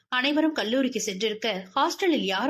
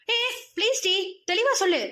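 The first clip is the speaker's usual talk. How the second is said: much higher, frightened please; panicked plea; 9 semitones higher than usual. Acted. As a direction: beg her not to throw the stone. She raises a stone to hit. (பயந்த கெஞ்சல்)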